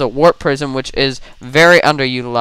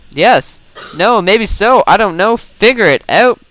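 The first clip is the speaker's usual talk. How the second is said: flustered; 6 semitones higher, 3 dB louder than usual. Real, not acted. Indecisive caster rambling at high pitch.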